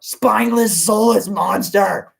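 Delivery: loud, slow furious cry; yelled. Acted. Troll father cursing the Bergen for his children, slow loud yell. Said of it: "he yelled".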